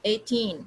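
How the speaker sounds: put-on eighteen with long falling TEEN; eighteen, weight on second syllable; stress on TEEN. Shown as a demonstration. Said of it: "टीन पे".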